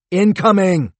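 loud, high warning shout; yelling while running away. A narrator's reading. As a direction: scream the warning. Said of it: "he shouted to the Hadids".

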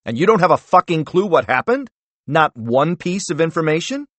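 furious demand. Acted. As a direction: tear into him. Senator furious at director.

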